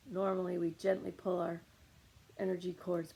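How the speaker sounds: ordinary delivery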